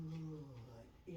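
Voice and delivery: low, soft, drawn-out good; elongated good. Shown as a demonstration. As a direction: stretch the word good long and low.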